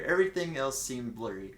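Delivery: ordinary delivery, nothing marked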